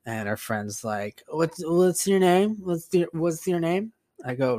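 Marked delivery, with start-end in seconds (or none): 1.3-3.9 slow, higher imitated drunk question; drunk friend. Drunk friend asks painter his name, slow mock-tipsy voice. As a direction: imitate a tipsy woman asking, stumbling over the words. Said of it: "stumbling all drunk".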